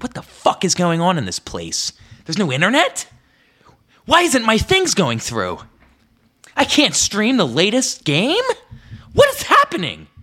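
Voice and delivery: high, loud exasperated yelling; frustrated rant. Retold or imitated. Retelling frustration at slow internet.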